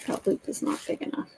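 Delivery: breathy whisper under her breath; quiet whispered grumble; whispered aside. Real, not acted. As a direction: whisper it to yourself. Muttering to herself while crafting.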